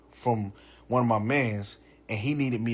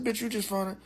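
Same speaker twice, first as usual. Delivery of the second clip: blurted incredulous question; 11 semitones higher than usual. Retold or imitated. Man replaying his question to his date, high-pitched disbelief. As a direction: re-enact a high, disbelieving accusation. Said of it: "I said it just like that".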